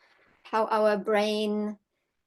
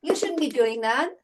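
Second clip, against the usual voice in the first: higher, fast indignant scolding, imitated; mock anger at the horse; 6 semitones higher than usual. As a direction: scold the horse like an offended owner. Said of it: "Maybe I'm pissed".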